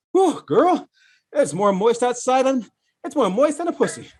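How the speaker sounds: a hint of a gossiping girlfriend; mock woman's voice. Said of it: "you wouldn't hear women say".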